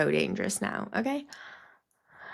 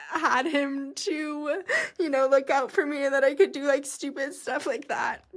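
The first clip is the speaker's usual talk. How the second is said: high, tearful voice, laughing and crying at once; real tears behind a laugh; crying through laughter; 4 semitones higher than usual. Real, not acted. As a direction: let the grief break through the laughter. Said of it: "And I'm crying because this is grief".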